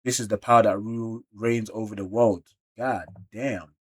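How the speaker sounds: ordinary talk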